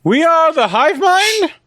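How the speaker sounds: high, rising questioning voice; puzzled call. Acted. Player answers whispering voices in character, puzzled call.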